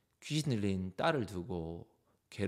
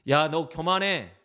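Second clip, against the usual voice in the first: louder, slow, pointed accusation; blunt accusation; 5 semitones higher, 5 dB louder, slower than usual. Acted. Preacher acting out telling someone they are proud, blunt accusation. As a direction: call them out loudly and slowly.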